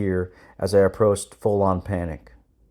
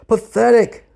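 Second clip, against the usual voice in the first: high, loud sergeant's put-down; shouted rebuke; 8 dB louder, slower than usual. A narrator's reading. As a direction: yell it in contempt at failing recruits. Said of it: "Sergeant Sampson shouted".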